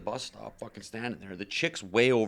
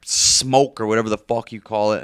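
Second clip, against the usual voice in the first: ordinary talk, nothing marked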